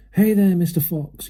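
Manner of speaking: high taunting yell. A narrator's reading. Bunce taunting the fox from his tractor, high yell. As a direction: call it down mockingly. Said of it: "yelled Bunce".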